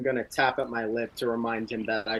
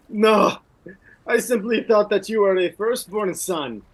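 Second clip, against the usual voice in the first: higher, louder scornful taunt; mocking warrior; 6 semitones higher, 7 dB louder than usual. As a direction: insult his lineage with scorn. Brynjar mocks the Marquis.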